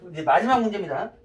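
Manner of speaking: normal talking voice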